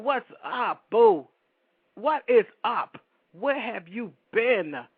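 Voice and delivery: loud homegirl greeting. Retold or imitated. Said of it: "That's how she sounds".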